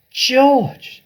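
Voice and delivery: very high, loud call; an eager father calling to his son; shouting. A narrator's reading. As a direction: bellow the boy's name. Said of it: "Mister Cranky shouted".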